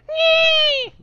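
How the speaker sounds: super high-pitched loud squeal; Ben Shapiro. Retold or imitated. Guest answering a softball question, super high-pitched squeal. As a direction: squeal it as a piercing mock voice. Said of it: "'cause he has a super high-pitched voice".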